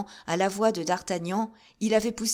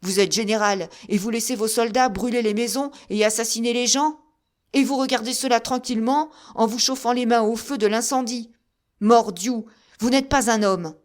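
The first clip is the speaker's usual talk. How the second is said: loud, raised, furious reproach; indignant outburst; 4 semitones higher, 7 dB louder than usual. A narrator's reading. Musketeer berating the general, loud and furious. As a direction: hurl the accusation at him.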